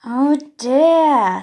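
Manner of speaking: loud falling oh dear; parents dismayed. Acted. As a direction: sigh it out as worried parents. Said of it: "says Mum and Dad".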